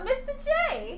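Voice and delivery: high cartoon Harley Quinn call. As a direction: squeak it up like Harley. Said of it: "there you go".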